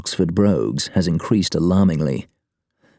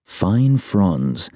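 usual speaking voice

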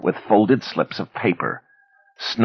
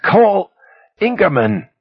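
much higher, loud pained cry; cry of agony; 5 dB louder than usual. A narrator's reading. Arm twisted, Ben cries out in agony. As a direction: cry out in pain. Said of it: "Ben cried out in agony".

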